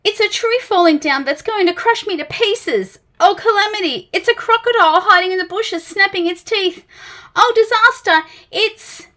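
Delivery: high, loud, fussy terror; a nervous little creature wailing in alarm; panicky Mister Jelly. Acted.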